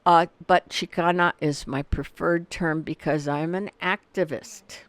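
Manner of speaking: ordinary talk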